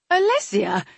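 high, loud, questioning repeat of a name; puzzled surprise. A narrator's reading. Mother confused by her son's introduction, puzzled surprise.

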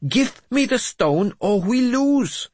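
very high, slow, loud plea; insistent. A narrator's reading. Mad bishop begs his lord for the stone, insistent. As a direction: demand it urgently, pitched right up. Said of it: "Jeremias insisted".

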